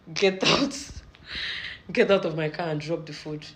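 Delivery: mock-stern command, loud on the first words; retold loud order. Driver throws two passengers out of his car, retold loud order.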